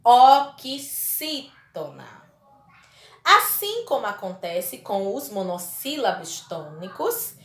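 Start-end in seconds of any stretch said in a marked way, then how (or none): 0.0-2.1 slow, loud "oxítona" in separate chunks; emphatic, broken-up teaching of "oxítona"; syllable-by-syllable. Shown as a demonstration. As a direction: stretch out "oxítona" piece by piece, firmly. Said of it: "são classificadas como".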